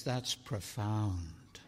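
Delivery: slow, hushed mock admiration; mock awe. Preacher mocks the world's hushed awe. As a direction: breathe it out as if awestruck. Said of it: "And the world says".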